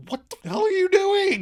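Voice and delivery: high-pitched indignant yelp; startled outburst. Acted. Lauren after Joey scares her, high startled protest. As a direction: react as if scared out of your wits.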